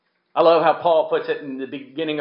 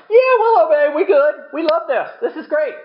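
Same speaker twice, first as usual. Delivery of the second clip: much higher, eager promising voice; mock eager people; 13 semitones higher, 4 dB louder than usual. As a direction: ham up the people's eager yes. Israel promising at Sinai, acted.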